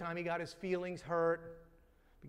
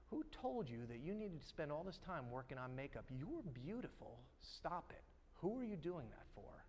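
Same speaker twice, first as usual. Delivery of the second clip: hushed fatherly plea; 4 semitones lower, 9 dB quieter than usual. Retold or imitated. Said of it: "I started going".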